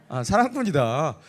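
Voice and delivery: ordinary delivery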